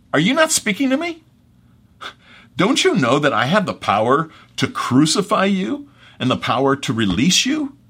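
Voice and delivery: high, loud exasperated demand; ticked off. Acted. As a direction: demand an answer, voice jumping up. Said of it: "he's ticked".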